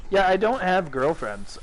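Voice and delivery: ordinary delivery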